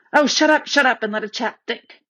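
irritated outburst. Acted. Peter cuts off his quarrelling siblings, irritated. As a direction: cut them off, annoyed, then trail into thought.

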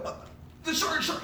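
high-pitched stammering alarm; mock-panicked squeak; retold panic. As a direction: act out stumbling over a warning. Retold panic as shark approaches.

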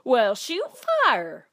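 parrot-like squawked catchphrase with jumping pitch; trained talking bird. Acted. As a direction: play a bird repeating a folksy phrase. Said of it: "birds that he'd trained to say clever things".

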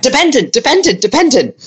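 high-pitched mock kid's shout, no real volume; imitating shouting child. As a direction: pipe it up like an eager pupil answering. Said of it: "the kid's shouting back".

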